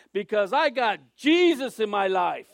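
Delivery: the lady's loud, beaming testimony, retold; loud joyful declaration. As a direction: proclaim it out loud and happy, a changed voice. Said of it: "she said it real loud".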